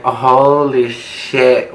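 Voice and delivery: very slow, drawn-out disbelief; acted shock. Teller shocked by the hallway scene, stunned disbelief. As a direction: stretch each word in mock astonishment.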